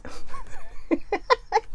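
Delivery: high breathy giggle at herself; a little burst of a giggle. Real, not acted. Reading a sweary card, she giggles at herself. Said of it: "where I just make myself giggle".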